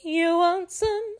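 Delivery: ordinary talk, nothing marked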